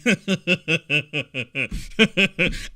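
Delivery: long low mock chuckle; low, rolling put-on laugh; imitated laugh. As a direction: imitate someone laughing off an accusation. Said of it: "you see he's laughing".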